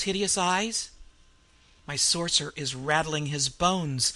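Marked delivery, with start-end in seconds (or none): none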